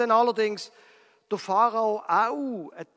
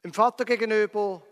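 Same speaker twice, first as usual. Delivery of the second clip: plain reading voice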